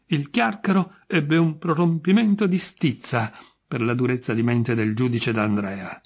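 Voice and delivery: plain reading voice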